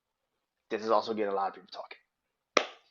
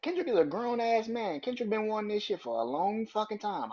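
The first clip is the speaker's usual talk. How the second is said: heated rant; 10 semitones higher than usual. Real, not acted. Vlogger ranting at [name], high and exasperated. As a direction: push it up in disbelief.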